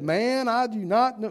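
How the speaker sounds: put-on playful touch of southern accent. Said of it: "Kind of a southern accent".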